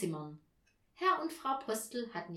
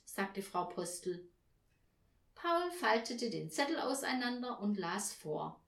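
plain reading voice

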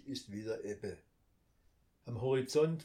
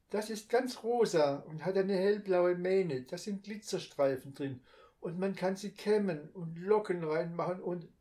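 put-on higher, louder eager child voice; chattering little girl; 7 semitones higher, 5 dB louder than usual. A narrator's reading. Little girl babbles to her brother about her pony. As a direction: gush on about the toy pony.

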